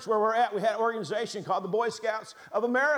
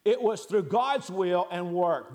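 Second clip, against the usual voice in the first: ordinary talk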